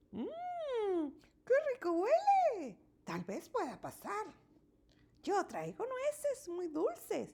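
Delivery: a smiling badger sniffs the food. A narrator's reading. Badger smells the food and offers nuts, smiling. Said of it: "dice Tejón sonriente".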